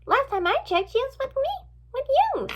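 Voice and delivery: put-on high-pitched, girlish voice; squeaky-high friend chiming in; chirpy girl. A narrator's reading. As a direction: pipe up high, rising as you go.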